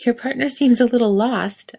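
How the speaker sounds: put-on cheerful young saleswoman. A narrator's reading. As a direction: say it brightly, amused. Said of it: "Elle said cheerfully".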